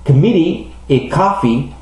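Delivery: "Committee" stressed on MIT, "coffee" on COF; exceptional stress. Shown as a demonstration. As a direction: keep the final "ee" light in "Committee" and "coffee". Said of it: "sont des exceptions importantes ici". (« Committee » et « coffee » sans accent sur la dernière syllabe)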